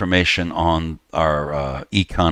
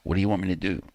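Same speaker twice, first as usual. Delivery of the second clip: ordinary talk, nothing marked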